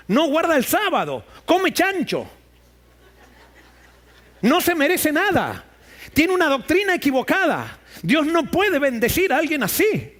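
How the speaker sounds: mock the pushy foreigner, rising in contempt; scornful disciples. Preacher voicing disciples who want the woman sent away, rising scorn.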